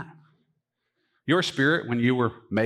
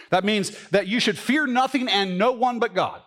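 raised preaching voice with weight on fear; preaching emphasis; 8 semitones higher than usual. Acted. Pastor urging the congregation, raised preaching emphasis. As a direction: drive home the point from the pulpit.

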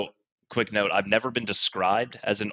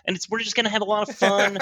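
higher and fast, gushing host; put-on podcast host voice; 9 semitones higher, faster than usual. [name] promises fun in a put-on eager host voice. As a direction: rush it out like an over-eager presenter. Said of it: "this is not my real voice".

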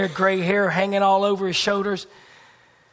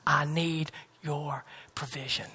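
hushed, pleading whisper; whispered prayer; 4 semitones lower, 11 dB quieter than usual. Pretend. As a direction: pray it under your breath.

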